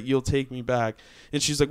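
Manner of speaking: ordinary talk, nothing marked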